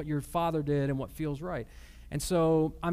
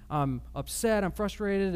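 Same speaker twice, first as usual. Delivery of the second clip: ordinary talk, nothing marked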